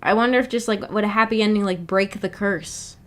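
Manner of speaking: ordinary talk, nothing marked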